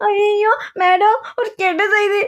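high, drawn-out tearful wail; crying. Acted. Bringing madam bad news, crying. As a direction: wail the words out in distress. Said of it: "ஏன் இப்படி அழுற?".

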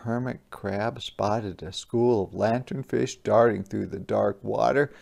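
ordinary delivery

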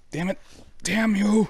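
loud, high-pitched exasperated cry; frustrated curse. Real, not acted. Player interrupted while reading curses at the game, loud frustration.

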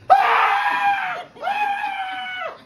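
long high terrified scream. Acted. Man screaming to block out fairy music. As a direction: scream out in terror. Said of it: "He screamed and screamed".

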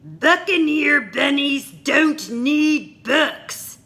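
loud, high, slow pirate growl of contempt; pirate captain scoffing. Acted. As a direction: drag it out like a scornful pirate.

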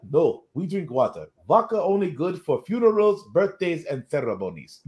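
put-on terrible Russian accent; Rocket Red. Russian hero explaining when vodka is allowed, bad Russian accent. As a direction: list it off in a hammed-up Russian accent. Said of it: "it's a terrible Russian accent".

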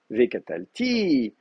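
"V catal, ti" rising onto a long final TI; stress on final TI. Shown as a demonstration. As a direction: push the accent to the end of "V catal, ti". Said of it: "à ce moment-là, vous savez".

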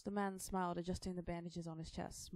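plain reading voice

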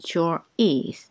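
very slow, each syllable clear; slow teaching pace; slow drill. Shown as a demonstration. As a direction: spell it out slowly for learners. Slow drill repeated twice.

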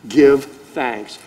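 ordinary talk

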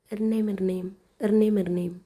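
imitated phone bell; phone ring.

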